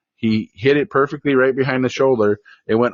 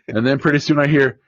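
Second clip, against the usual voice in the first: ordinary delivery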